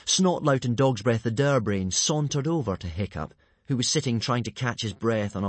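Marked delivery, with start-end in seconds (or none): none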